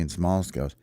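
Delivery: ordinary delivery, nothing marked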